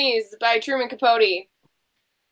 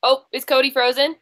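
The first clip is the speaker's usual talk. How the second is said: normal talking voice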